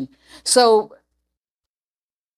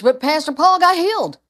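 punchline; 6 semitones higher, 4 dB louder than usual. Acted. Joking punchline in sermon.

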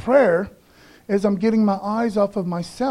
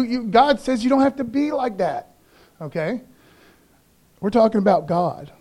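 usual speaking voice

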